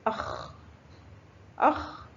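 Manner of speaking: "أخ" with vowelless kh friction at the end; sukun on khaa. Shown as a demonstration. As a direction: say "أخ" ending on a rasped kh. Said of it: "حرف الخاء فوقه سكون ينطق". (نطق "أخ" بخاء ساكنة)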